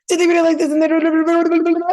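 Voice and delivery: loud, high, frantic gabble; racing pace. Shown as a demonstration. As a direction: rattle it off at top speed into babble. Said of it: "how was the pace?".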